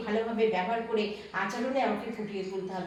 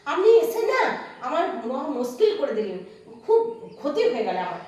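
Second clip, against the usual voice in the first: complaining; 3 semitones higher, 6 dB louder than usual. Acted. Mother complains to visitor.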